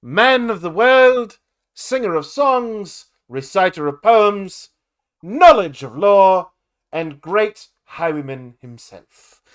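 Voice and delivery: loud, slow, pompous announcement; grand introduction. Acted. Bard introduces himself to the party with pomp. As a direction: proclaim your titles with a flourish.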